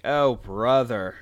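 slow, drawn-out eye-roll "Oh, brother"; exasperated. Retold or imitated. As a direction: sigh it out, fed up. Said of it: "I'm like".

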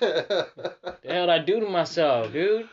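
slow, loud, high laughing lament; real laughter while talking. Real, not acted. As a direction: laugh through the regret. Laughing at his own story.

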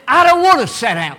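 high, loud stubborn refusal; the stubborn inner self digging in its heels; defiant self voice. Acted. The inner self refusing the order, high and loud. Self" answers back in acted dialogue.

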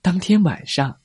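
usual speaking voice

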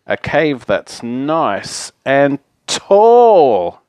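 slow build to a high, stretched last word; enthusiastic pitch. Acted. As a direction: sell it grandly, drawing out the end. Fox pitching a taller cave.

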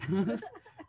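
risa aguda que se escapa; risa contenida. Real, not acted. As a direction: soltar una risita que no puede aguantar. No te rías. (risa contenida)

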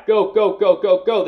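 loud, high chant of go, driving them on; shouted encouragement. Real, not acted. Coach cheering screaming participants on, loud high shout. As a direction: yell go go go over the group's screams.

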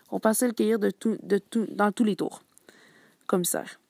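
lively reading of a novel passage with voiced dialogue; story narration. A narrator's reading. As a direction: read the excerpt to the class like a storyteller. Student reads second novel excerpt. (lecture expressive d'un roman à voix haute)

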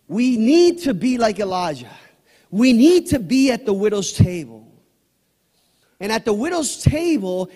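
rising preacher's call over music; fervent preaching. Acted. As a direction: preach it out to the church with fire.

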